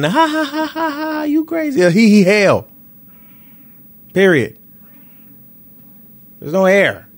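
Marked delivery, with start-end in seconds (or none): 0.0-1.8 high-pitched fake laugh running into the words; mocking laughter. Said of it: "people be laughing". 1.8-2.7 mock giggle retort. Throws the laughter back at them.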